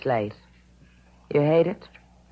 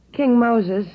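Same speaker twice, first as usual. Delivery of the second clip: raised voice calling across the room; higher, louder call across a smoky bar; 7 semitones higher, 7 dB louder than usual. Acted. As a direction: call out to the piano player.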